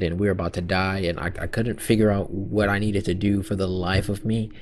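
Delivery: normal talking voice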